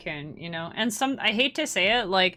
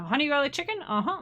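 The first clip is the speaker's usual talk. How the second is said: order-taker imitation; 7 semitones higher, faster than usual. Restaurant worker repeating a customer's order, high questioning imitation. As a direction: repeat the order back, lifting the end. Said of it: "they always repeat your order".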